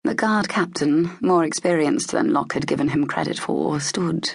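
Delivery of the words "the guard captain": ordinary delivery, nothing marked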